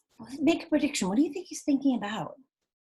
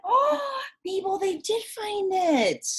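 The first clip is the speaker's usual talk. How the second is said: surprised; 9 semitones higher, slower than usual. Real, not acted. Teacher gasps at the find.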